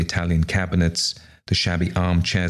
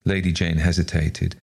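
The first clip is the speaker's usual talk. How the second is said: plain reading voice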